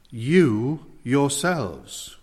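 "you" and "yourselves" each stressed, very slowly; emphatic YOU YOURSELVES. Shown as a demonstration. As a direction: set "you" and "yourselves" apart, drawn out with weight. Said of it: "it's so emphatic".

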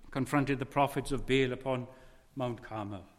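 usual speaking voice

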